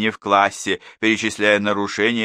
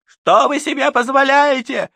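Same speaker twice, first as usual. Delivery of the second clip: much higher, louder outraged cry; outraged exclamation; 7 dB louder than usual. A narrator's reading. One mother confronts the teacher, outraged and loud. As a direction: throw it at the teacher. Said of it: "воскликнула одна из них".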